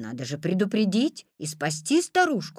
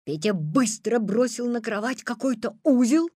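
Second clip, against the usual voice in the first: ordinary delivery, nothing marked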